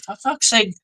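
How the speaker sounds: high, exasperated whine; fed-up defenders begging in a high voice. Retold or imitated. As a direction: plead in mock frustration. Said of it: "So everyone's like".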